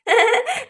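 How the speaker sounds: short giggle; pleased little laugh; light laugh. Acted. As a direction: giggle at the end of the rhyme. Child laughs after poem.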